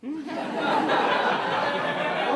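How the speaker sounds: breathy laugh. Real, not acted. Laugh after answer.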